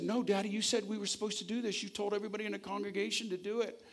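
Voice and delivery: put-on a child begging his dad to keep his word; pleading little boy.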